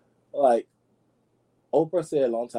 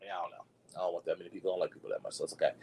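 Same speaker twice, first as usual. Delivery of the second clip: much softer, lower mumble to self; quiet aside; 5 semitones lower, 13 dB quieter, much faster than usual. Real, not acted. Vlogger mumbles to herself about disliking people, soft and low. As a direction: let it fall away into a mumble.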